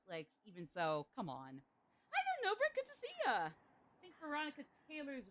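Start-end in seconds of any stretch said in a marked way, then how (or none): 2.1-3.3 much higher, sing-song greeting; high cheerful voice. Acted. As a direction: squeak out a friendly hello. Greeting a viewer in a voice.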